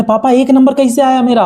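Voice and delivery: put-on high, wide-eyed whine of a kid asking dad; a son asking his furious father how he got one mark, high and pleading; innocent pleading son. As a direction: play the clueless son begging an answer.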